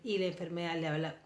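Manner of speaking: ordinary talk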